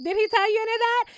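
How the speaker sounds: hammed-up indignant questioning; mock grilling. Commentator pretending to question the new love interest, mock grilling.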